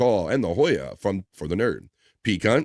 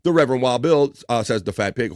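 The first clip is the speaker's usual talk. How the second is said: usual speaking voice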